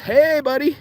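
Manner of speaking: high, sing-out fake-friendly greeting; mock a pal who wants a favour; needy buddy imitation.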